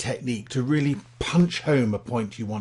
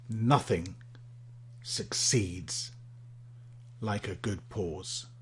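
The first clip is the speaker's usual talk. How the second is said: long pause after "Nothing" and after "succeeds", falling softer; dramatic pauses; 3 semitones lower, 10 dB quieter, much slower than usual. Shown as a demonstration. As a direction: let each word hang before the next. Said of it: "dramatic pauses are wonderful".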